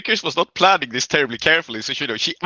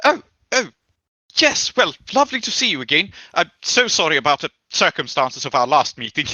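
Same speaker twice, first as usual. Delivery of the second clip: awkward greeting. Acted. Vickers apologises about their last meeting, awkward. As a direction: play a flustered, polite apology. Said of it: "just look kind of awkward".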